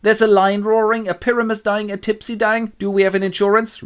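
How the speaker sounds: high, insistent amateur actor; worrying Bottom. Acted. Bottom questioning the director about danger, worried. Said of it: "But Bottom goes".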